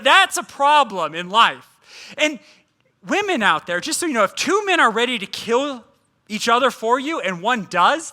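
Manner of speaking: usual speaking voice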